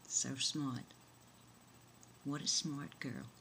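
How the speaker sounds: low, soft, doting praise; hushed admiration. Acted. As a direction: murmur it low, proud of your child. Mother marvels softly over child's card.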